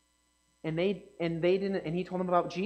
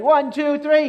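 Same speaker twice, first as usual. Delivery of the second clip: loud count; 5 semitones higher, 9 dB louder, slower than usual. Shown as a demonstration. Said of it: "Let's see if the adults can do it too".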